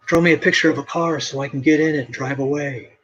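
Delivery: a stranger hollering at a passing artist; retold yell. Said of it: "He was yelling at me".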